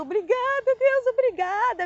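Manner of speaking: high-pitched, grateful cry; overjoyed thanks. Retold or imitated. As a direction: re-enact thanking God, voice lifted high with joy. Replies thankfully to God. (agradecimento emocionado, voz bem aguda)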